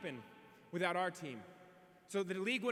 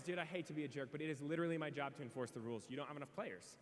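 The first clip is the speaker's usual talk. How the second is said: soft, lower, regretful ruling; apologetic umpire; 5 semitones lower, 8 dB quieter than usual. Retold or imitated. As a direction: break bad news gently. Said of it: "the umpire goes".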